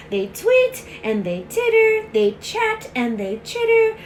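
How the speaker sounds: bright, bouncy reading; lively. A narrator's reading. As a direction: bounce through the party. Lively animal party, read aloud.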